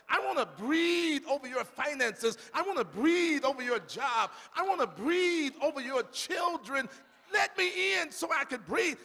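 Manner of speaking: fervent sermon climax; preaching at full force. Acted. Preaches as God, building.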